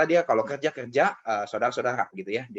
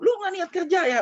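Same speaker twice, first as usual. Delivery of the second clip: very high, loud scolding yell; angry scolding; 5 dB louder than usual. Retold or imitated. Uncle lashing out at nephew first morning, angry yell. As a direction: snap it out high and loud, straight in. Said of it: "Wah, udah diomelin".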